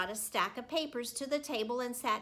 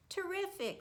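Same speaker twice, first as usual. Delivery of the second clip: bright, much higher "Terrific" with a smile; smiling praise; 10 semitones higher than usual. A narrator's reading. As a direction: praise her warmly with a smile.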